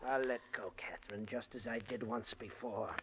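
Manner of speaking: soft, low, deadly whisper; a killer's hushed threat; menacing whisper. Acted. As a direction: breathe the threat right in her ear.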